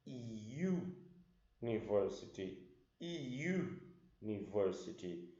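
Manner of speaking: "university" starting with a y sound, "yoo-niversity"; "university" said with a y glide before the u; right pronunciation. Shown as a demonstration. Teacher shows the right way to say the word. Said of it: "Você fala".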